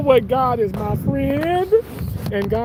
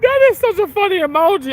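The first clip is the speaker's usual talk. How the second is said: laughing apology; 10 semitones higher, 4 dB louder than usual. Real, not acted. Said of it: "I'm trying not to laugh".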